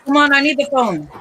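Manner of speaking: loud, high, impatient holler; impatient call. Real, not acted. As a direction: chivvy someone from across the house. Impatiently calling for her phone.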